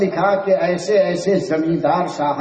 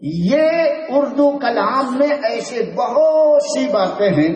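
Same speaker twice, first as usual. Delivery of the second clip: ordinary delivery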